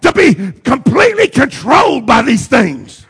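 driving sermon cadence with punched key words; full-force preaching. Acted. Preacher urging the church to lay sins aside, impassioned preaching. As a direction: preach it hard, rolling through each repetition.

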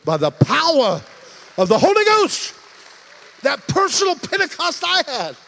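loud, high fervent preaching; preaching at full force. Acted. Preacher roaring to the church. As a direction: cry it out to the congregation.

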